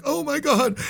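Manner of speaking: high-pitched panicky exclamation, acted out; mock freak-out. Host mimics the man on trial with God, panicking. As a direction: imitate someone panicking, voice jumping way up. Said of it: "This guy's freaking out".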